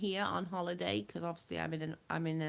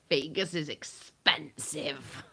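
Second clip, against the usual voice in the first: imitating Big Carrie; 5 dB louder, slower than usual. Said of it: "Big Carrie's like".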